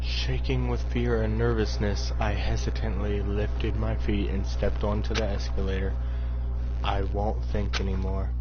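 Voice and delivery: story narration. Acted. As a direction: read it like inner narration. Reads the game narration.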